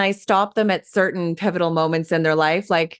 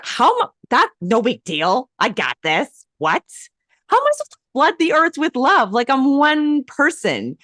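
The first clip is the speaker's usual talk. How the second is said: incredulous disbelief; 7 semitones higher than usual. Retold or imitated. Retold panicked self-talk.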